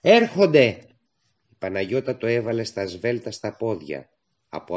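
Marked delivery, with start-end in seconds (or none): 0.0-0.8 loud, high alarm cry; girl running up with an alarmed shout; breathless warning. Acted. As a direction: warn them they are coming. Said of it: "άκουσε λαχανιτά και γυναικεία φωνή".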